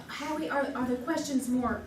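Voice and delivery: ordinary talk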